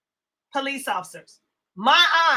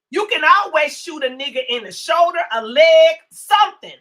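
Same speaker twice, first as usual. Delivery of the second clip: heated outburst; 7 dB louder than usual. Real, not acted. Heated point about police force.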